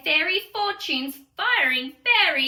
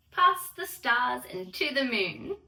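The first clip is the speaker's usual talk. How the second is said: ordinary delivery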